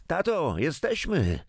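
high bright call from the doorway; daughter calling out. A narrator's reading. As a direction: call out cheerfully to father on arriving. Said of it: "zawołała od progu Marzena".

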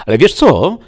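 high, loud springing question; sudden interjection. Acted. Dreamer springing his trick on the apparition, suddenly eager. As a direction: burst in with a sudden idea.

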